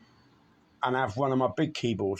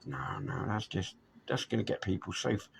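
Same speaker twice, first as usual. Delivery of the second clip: much softer, low dismissive reply; muttered no; 4 semitones lower, 7 dB quieter than usual. Retold or imitated. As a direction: mutter it off under your breath. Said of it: "I said".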